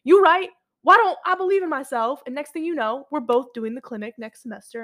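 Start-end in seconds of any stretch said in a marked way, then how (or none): 0.1-2.2 higher, louder mock epiphany; dramatic realization. To her friend Hope, a hammed-up sudden realization. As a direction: swept up by her own dramatic turnaround. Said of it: "And I'm just like".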